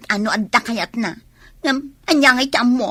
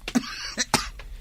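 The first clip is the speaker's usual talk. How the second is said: weak cough; 8 dB quieter than usual. Acted. Sick character coughing.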